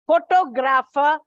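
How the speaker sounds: pho-TOG-rapher, punch the second syllable; stress on second syllable. Shown as a demonstration. Teacher showing the class where the stress falls, TOG punched. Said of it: "it is on the second syllable".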